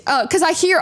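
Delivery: ordinary talk, nothing marked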